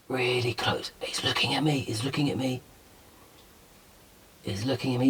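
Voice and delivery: very soft, hushed whisper; real hushed wonder, barely voiced; awed whisper. Real, not acted. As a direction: whisper so as not to startle the animal. Hushed beside a roosting bat.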